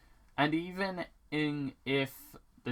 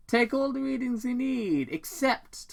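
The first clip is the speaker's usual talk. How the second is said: high put-on homeowner voice; folksy dad voice; 13 semitones higher, 3 dB louder than usual. Tom to Robotnik, high put-on dad voice. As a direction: sound friendly then stop short. Tom's voice in story.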